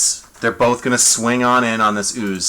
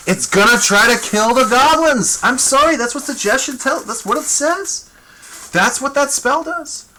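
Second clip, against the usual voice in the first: excited high disbelief; 13 semitones higher than usual. Real, not acted. Spell makes monster turn on allies.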